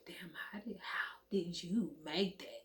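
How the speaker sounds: awed breathless whisper. Retold or imitated. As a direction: whisper it to yourself in wonder. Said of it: "I'm like".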